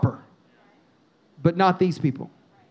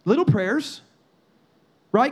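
ordinary talk

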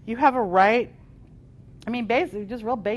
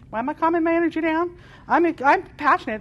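fast, higher and louder indignant comeback; indignant retort; 7 semitones higher, 5 dB louder, faster than usual. Retold or imitated. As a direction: fire back in passionate defiance. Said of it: "I'm just like".

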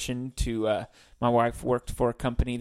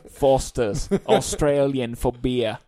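mock Aussie ad line; 6 dB louder, slower than usual. Aussie accent jokes after accent talk.

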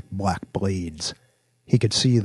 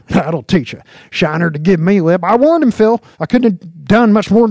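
high, loud swaggering crowing; chuckling lawman boast; 12 semitones higher, 11 dB louder than usual. A narrator's reading. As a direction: crow to your partner after downing the suspect. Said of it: "Jinx chuckled".

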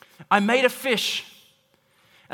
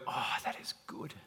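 breathy delight; 8 semitones lower, 14 dB quieter than usual. Acted. Psalm writers' delight voiced.

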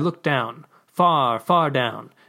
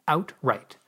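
usual speaking voice

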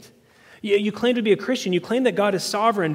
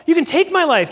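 much higher, louder bold defiance; defiant Jesus; 9 semitones higher, 6 dB louder than usual. Retold or imitated. Jesus answering Pilate without fear, higher and louder. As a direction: fling it back fearlessly.

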